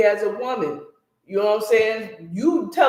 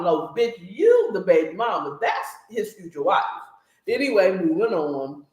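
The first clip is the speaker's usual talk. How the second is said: normal talking voice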